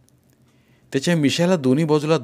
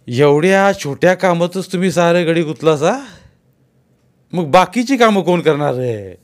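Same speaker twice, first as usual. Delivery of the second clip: a landlord's raised, scolding voice, moderately higher; 6 semitones higher, 5 dB louder than usual. Acted. Landlord scolding farmhands for wasting men, a touch raised. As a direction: chide them like an impatient village boss.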